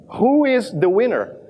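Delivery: ordinary talk, nothing marked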